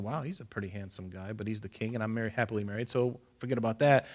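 soft, hushed inner thought; Bathsheba's private thought, softly; Bathsheba musing. Retold or imitated. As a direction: think it quietly to yourself.

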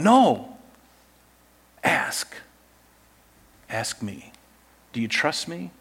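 soft, near-whispered divine invitation; hushed God voice. Pretend. God speaking to the doubter, hushed and tender. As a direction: lean in and murmur it like a gentle God. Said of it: "God's going".

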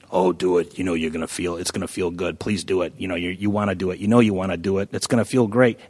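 low sly coaxing devil; little devil. Acted. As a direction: coax him like the devil on his shoulder. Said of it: "the little devil".

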